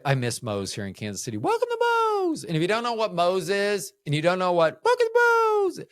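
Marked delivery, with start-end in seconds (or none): 1.4-2.4 high-pitched yelled restaurant welcome; yelled greeting. Acted. Worker greeting customers at Moe's, yelled high. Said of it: "just to yell that". 4.8-5.8 loud, high-pitched restaurant holler; yelled greeting. Acted. Wanting the job just to yell that, loud high yell. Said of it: "just to yell that".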